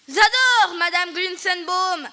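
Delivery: put-on boy lisps his compliment through a mouthful of crumbs; lisping. A narrator's reading. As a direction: lisp through a mouthful of cake. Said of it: "a zozoté Jean E".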